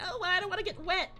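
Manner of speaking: high, fast, airy mockery of careless friends; mocking the casual teammates in a high silly voice. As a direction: mimic them as silly and unbothered. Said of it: "they're all just like".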